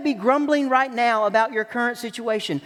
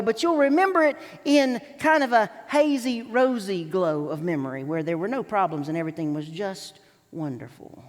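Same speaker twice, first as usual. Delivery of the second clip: ordinary talk, nothing marked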